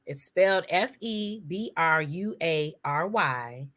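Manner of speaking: usual speaking voice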